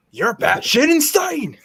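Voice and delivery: hammed-up game villain line; Metal Gear Rising quote. Quoting a Metal Gear Rising villain to friends, hammed up. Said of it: "Metal Gear Rising".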